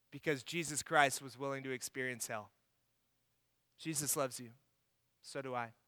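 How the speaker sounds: soft, sincere closing words; tender close. Real, not acted. Pastor closing the sermon to his listeners, soft and tender.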